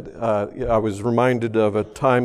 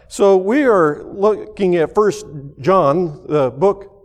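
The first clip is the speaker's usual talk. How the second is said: louder and higher, pressing each point; preaching; 5 semitones higher, 4 dB louder than usual. Acted. Pastor preaching to the congregation about God's love, louder and higher. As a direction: drive the message home from the pulpit.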